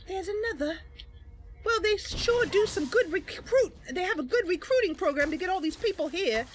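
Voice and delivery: mock the enemy's recruiting; wry character voice. Mercy quipping about endless enemies, high sarcastic voice.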